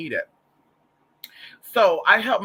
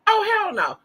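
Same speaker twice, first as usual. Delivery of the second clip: very high, loud incredulous outburst; imitated mama in high-pitched disbelief; mock outraged mother; 5 dB louder, slower than usual. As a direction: shriek it like an outraged mom. Retelling mother's outraged reaction.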